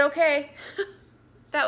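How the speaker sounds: real crying breaking through the words; tearful, voice cracking; sobbing through a brief laugh. Real, not acted. Last call with husband, crying.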